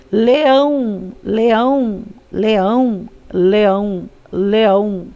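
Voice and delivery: "leão" repeated slowly with "ão" held long; drawn-out stressed ending. Shown as a demonstration. Teacher repeating for pupils to hear the accent, long stressed "ão" in "leão". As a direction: stretch the final "ão" of each "leão". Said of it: "Acento aí na palavra leão".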